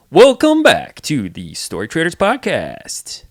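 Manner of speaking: big booming announcer, slow and loud. Shown as a demonstration. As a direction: open the show like a fighting game announcer. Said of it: "an announcer voice?".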